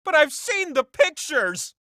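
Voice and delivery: high, scandalized squeal; scandalized gasp. Acted. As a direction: gasp it out like juicy gossip. Gossipy aside about Sin City.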